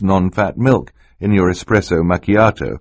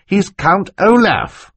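slow, higher, pointed; impatient; 12 semitones higher, 4 dB louder, slower than usual. A narrator's reading. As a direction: spell it out impatiently. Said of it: "Klaus said impatiently".

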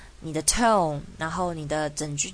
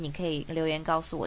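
ordinary talk, nothing marked

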